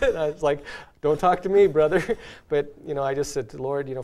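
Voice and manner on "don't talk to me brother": louder, higher comic refusal; mock protest. Guilty husband to a brother seeking marriage advice, mock protest. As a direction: push the caller away in mock alarm. Recalls reaction to troubled caller.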